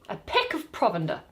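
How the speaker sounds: usual speaking voice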